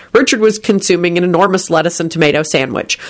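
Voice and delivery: ordinary delivery, nothing marked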